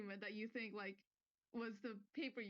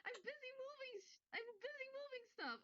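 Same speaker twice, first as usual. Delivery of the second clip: much higher, complaining whine; whiny assistant; 12 semitones higher than usual. Acted. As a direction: protest like a put-upon helper. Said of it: "the other voice say".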